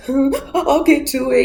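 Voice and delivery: high, slow, tearful promise; mock crying. Bride answering the inmate on the phone, mock crying. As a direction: whimper it through put-on sobs. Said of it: "she starts crying".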